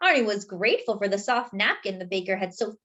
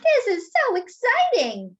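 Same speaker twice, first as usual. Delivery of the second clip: much higher and louder, thrilled; excited Arnie; 12 semitones higher, 5 dB louder than usual. A narrator's reading. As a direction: squeal with delight. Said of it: "said Arnie".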